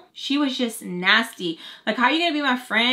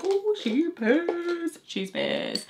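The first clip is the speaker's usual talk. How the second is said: knowing drawl; 8 semitones higher, much slower than usual. Retold or imitated. Retelling her own reaction to hang-up.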